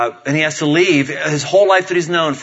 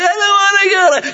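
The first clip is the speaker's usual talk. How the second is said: very high mock child's wail; complaining little girl; 4 dB louder than usual. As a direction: protest in a squealing kid voice. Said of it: "She's complaining".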